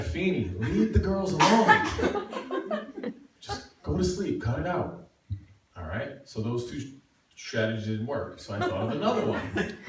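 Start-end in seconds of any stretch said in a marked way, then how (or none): 0.0-5.1 1 annoyed RA. Retold or imitated. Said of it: "He's like".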